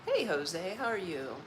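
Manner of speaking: ordinary delivery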